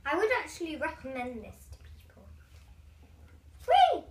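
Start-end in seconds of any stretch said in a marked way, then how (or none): 3.7-4.0 high, loud whee; excited squeal. Real, not acted. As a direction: squeal with glee. Spinning the wheel.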